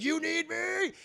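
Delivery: very high, squealed question; hammed-up, high-pitched disbelief; overjoyed Electro. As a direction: burst out in mock amazement. Electro thrilled at being needed.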